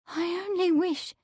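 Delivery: high, tremulous, tentative start; trembling. A narrator's reading. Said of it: "she began tremblingly".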